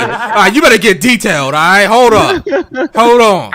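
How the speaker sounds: mock scolding. Real, not acted. Teasing demand for detail.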